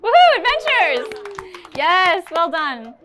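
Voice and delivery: loud, high, drawn-out celebration; whooping cheer. Real, not acted. Speaker congratulating a brave audience volunteer, whooping cheer. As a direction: cheer them on, stretching the words out loud.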